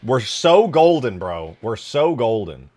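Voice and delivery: loud, drawn-out gloating delight; elated celebration. Real, not acted. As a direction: celebrate big, stretching the key word. Celebrates a lucky discovery.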